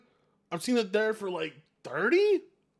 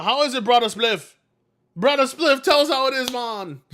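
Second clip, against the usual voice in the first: higher, louder put-on Jamaican greeting; playful Jamaican voice, higher and louder; mock Jamaican hail; 7 semitones higher, 6 dB louder than usual. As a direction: call out to Brother Spliff like a Jamaican pal. Hailing Brother Spliff in a voice.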